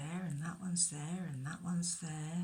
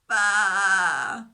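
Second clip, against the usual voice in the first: a loud, drawn-out weary baa; louder tired bleat; 13 dB louder than usual. Acted. Stuck lamb bleats louder to reach the shepherd. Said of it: "a little bit louder bleat, but very tired".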